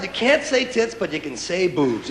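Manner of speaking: ordinary talk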